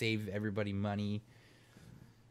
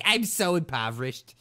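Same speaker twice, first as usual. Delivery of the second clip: mock poor girl; 3 semitones higher than usual. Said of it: "she's sitting in it like".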